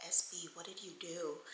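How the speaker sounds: very soft, low question; hushed dread. Retold or imitated. Friend confronts shaking man after the call, hushed. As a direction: ask under your breath, imitating alarm.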